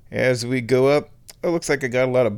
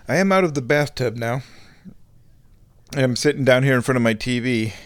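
ordinary talk, nothing marked

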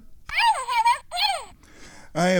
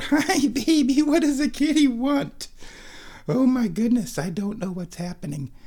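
much higher doting pet voice; 12 semitones higher than usual. Real, not acted. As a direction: fuss over the kitty. Greeting the cat.

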